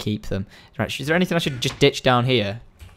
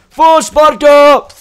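loud, slow, higher war cry; battle cry; 10 semitones higher, 10 dB louder, much slower than usual. Pretend. Gamer charging into danger with a slow loud battle cry. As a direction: bellow it like a Spartan charging. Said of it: "battle cry".

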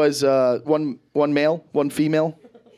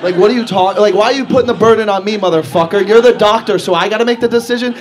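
loud, higher-pitched outraged protest; indignant rant; 4 semitones higher, 9 dB louder than usual. Acted. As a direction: throw the blame back, loud and fast. Indignant retort to the vet.

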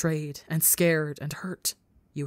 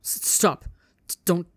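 stammering. A narrator's reading.

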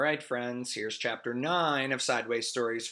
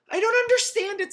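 put-on upset girl voice; 8 dB louder than usual. Morisa voiced in story.

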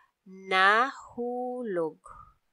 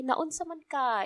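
higher, soft and soothing question; calm the patient as you ask; gentle doctor asking what happened; pacifying tone; 7 semitones higher than usual. Shown as a demonstration. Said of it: "more like pacifying, a softer side".

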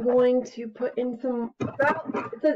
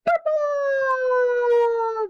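high, loud drawn-out cry; mice shouting; 3 dB louder than usual. Acted. Mice all shouting the new colour, high and loud. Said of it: "they all shouted".

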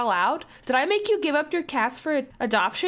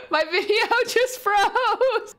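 much higher, slow, laughing through the words; 9 semitones higher, slower than usual. Real, not acted. A gamer laughing as her recording freezes after the death. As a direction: giggle helplessly at the disaster.

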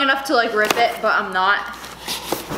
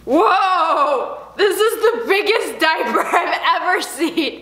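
laughing amazement; 4 semitones higher, much slower than usual. Real, not acted. Huge diaper amazement.

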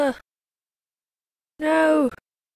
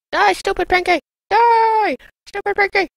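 higher, mock battle yell at the slime; battle cry; 7 semitones higher, 3 dB louder than usual. Real, not acted. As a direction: shout down the enemy.

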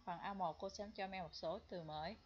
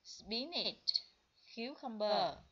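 teacher drill; 2 semitones higher, 7 dB louder, much slower than usual. Shown as a demonstration. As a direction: list each word clearly and loudly. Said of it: "em đọc những từ này lại với cô". (đọc to từng từ cho học sinh lặp lại)

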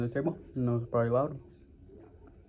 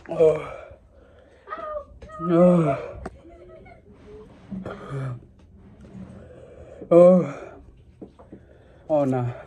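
pained groans; 4 semitones higher, much slower than usual. Real, not acted. Suffering spicy burn, crying.

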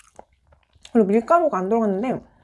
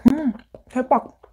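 talking between bites; chewing while talking; 3 semitones higher than usual. Real, not acted. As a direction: mouth full of cake. Seen 0: bit cake at -5; lips pursed, cheeks working through words.

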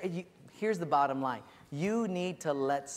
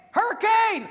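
shouted alarm; 9 dB louder than usual. Acted. Prisoner distracting the firing squad, high alarmed shout. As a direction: bellow a mock storm warning.

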